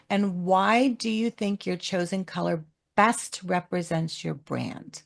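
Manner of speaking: usual speaking voice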